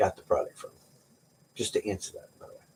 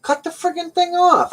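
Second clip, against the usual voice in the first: mock advice. Joking advice to viewer's knee.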